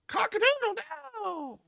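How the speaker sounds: high, slow rooster crow; crowing proud rooster. Acted. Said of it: "This is what I'll sound like".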